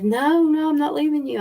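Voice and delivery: put-on a scared boy begging not to go; pleading child. Child refusing to leave mother.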